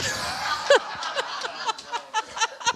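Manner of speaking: airy, high laugh; real breathy laugh at the punchline; breathless laugh. Real, not acted. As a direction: laugh helplessly at the absurd story. Laughing at the sunflower seed story.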